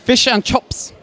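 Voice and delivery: put-on New Zealand accent, "chips" said as "chops"; a light mock Kiwi "Fish and chops"; quick imitation of a New Zealand accent. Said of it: "They talk like this".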